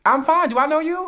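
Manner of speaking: high, loud, guarded reply; curt suspicious retort. Retold or imitated. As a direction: shut them down. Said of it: "so offended by how I said that".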